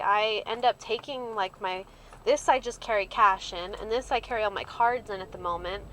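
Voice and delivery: usual speaking voice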